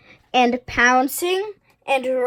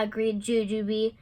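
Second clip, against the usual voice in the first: ordinary delivery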